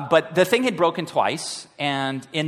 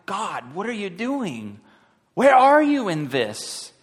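anguished plea; 6 semitones higher, slower than usual. Retold or imitated. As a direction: plead with God in frustration. Pleading prayer, retold.

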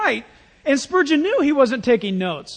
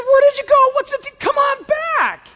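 high, loud, pleading cry of dismay; upset shout; 6 dB louder than usual. Retold or imitated. Spurgeon calling the critic back, upset high shout. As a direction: call after him, distraught and pleading. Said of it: "And Spurgeon got so upset".

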